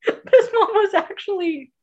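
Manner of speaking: high, giggly restart; laughing. Real, not acted. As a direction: try to speak through the giggles. Still laughing as she restarts.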